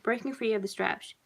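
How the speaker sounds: ordinary delivery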